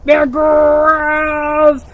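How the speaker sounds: lively storybook reading for little ones; animated picture-book narration; reading to children. A narrator's reading. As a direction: read it like storytime with kids.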